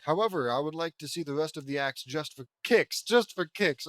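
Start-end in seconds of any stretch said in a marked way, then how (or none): 3.0-3.9 sarcastic echo. Shown as a demonstration. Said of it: "'cause that's not totally fucking insulting".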